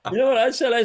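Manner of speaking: giggling as she sets up her comeback; laughing. Real, not acted. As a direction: laugh out the question before the punchline. Laughing while telling the punchline.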